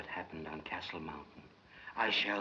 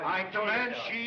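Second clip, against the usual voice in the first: loud, high-pitched shout of outrage; outraged demand; 12 semitones higher, 7 dB louder than usual. Acted. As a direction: shout the demand, stretching the last word. Outraged demand after being insulted.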